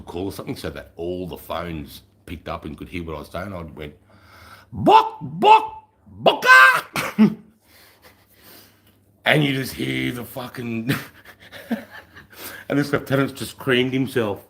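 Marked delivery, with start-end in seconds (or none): 4.7-7.4 loud, very high chicken clucking; mock chicken clucks over the radio; chicken noise. Chicken call over all phones. 10.9-12.6 muffled laughter. Retold or imitated. Everyone laughing over the phone.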